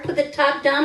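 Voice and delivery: plain reading voice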